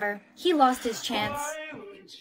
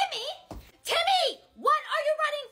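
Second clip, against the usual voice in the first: much higher, louder call across the hall; 12 semitones higher, 3 dB louder than usual. Acted. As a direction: call his name to stop him. Calling out to running Timmy.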